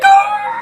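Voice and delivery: high loud shriek of surprise; yelp. Retold or imitated. C shrieking as his friend falls off the chair. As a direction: yelp like you just saw him fall. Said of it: "C's like".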